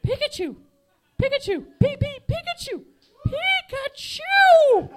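squeaky, high, loud Pokemon cry. Acted. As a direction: squeal the name like a cartoon creature. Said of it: "my impression of myself as a Pokemon".

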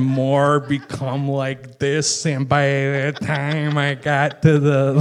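slow, stretched Chicago vowels; thickening Chicago accent. Shown as a demonstration. Guest showing his voice turning Chicago on the news. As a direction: let the Chicago accent creep in. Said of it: "my voice started to slowly more".

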